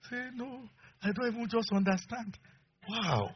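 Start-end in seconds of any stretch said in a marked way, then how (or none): 0.3-2.4 tearful wife. Retold or imitated. Wife voiced crying.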